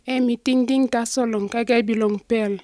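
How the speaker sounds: ordinary talk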